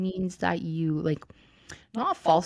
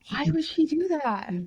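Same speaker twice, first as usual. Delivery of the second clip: incredulous; 8 semitones higher than usual. Real, not acted. Reacting to the story.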